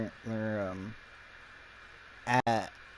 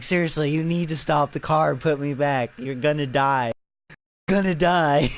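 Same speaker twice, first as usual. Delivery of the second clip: raised-voice retold plea; urgent warning; 3 semitones higher, 6 dB louder than usual. Ass Face to the cop from the car, acted urgent warning. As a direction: plead loudly, as if imitating a desperate captive.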